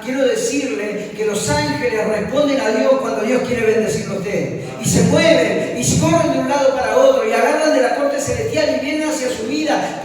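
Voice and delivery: high, loud, driving sermon; impassioned preaching. Real, not acted. Preacher telling the congregation their worth before God, impassioned.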